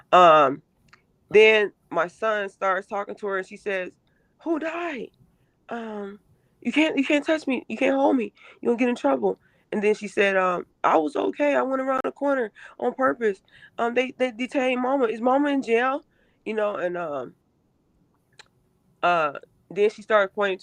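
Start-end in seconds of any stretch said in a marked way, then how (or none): 4.4-16.0 put-on higher, childlike voice for the daughter; imitating her daughter. Mother voicing her frightened daughter's hospital words in a higher voice. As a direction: lift the voice up into the daughter's pleading tone.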